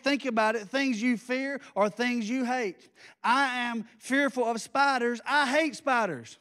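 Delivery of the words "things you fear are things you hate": usual speaking voice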